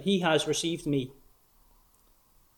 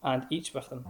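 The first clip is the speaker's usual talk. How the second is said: scornful quote; 7 dB quieter than usual. Retold or imitated. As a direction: quietly sneer out the scribes' complaint. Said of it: "They are scornful words".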